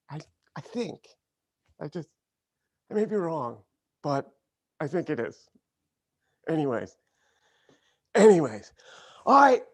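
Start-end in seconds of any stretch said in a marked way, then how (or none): 0.1-5.5 softer, hesitant acted backpedalling; mock timid hedging. Host to Southern friend, mock timid hedging. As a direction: trail off meekly in halting pieces.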